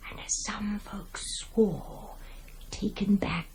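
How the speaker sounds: soft eerie whisper. A narrator's reading. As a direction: hush it down to a whisper. Narrator drops to a whisper.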